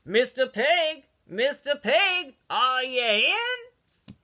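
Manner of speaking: loud, slow sing-song call at the door; drawn-out call to someone inside. Retold or imitated. Wolf calling out to the pig at his door, slow and loud. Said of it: "I called out".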